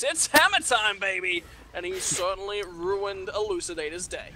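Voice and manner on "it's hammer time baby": louder hype call; excited. Real, not acted. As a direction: shout it like a big play. Caster hyping a big play.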